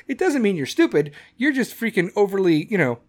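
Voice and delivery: normal talking voice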